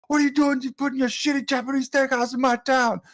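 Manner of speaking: high-pitched mock-accented cartoon rant; imitated angry yelling. Owner confronting flyers for rival steakhouse, imitated yelling. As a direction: act out an outraged cartoon owner. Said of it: "yelling at him".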